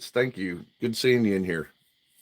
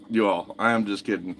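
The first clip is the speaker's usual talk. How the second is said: ordinary talk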